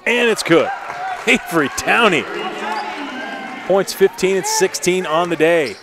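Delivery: high, loud excited call. Real, not acted. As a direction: call the basket with big excitement. Basket scored, crowd moment.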